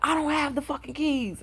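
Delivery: high-pitched mimicked protest; exasperated retort. Man retold protesting he lacks the keys, exasperated.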